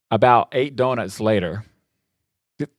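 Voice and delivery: normal talking voice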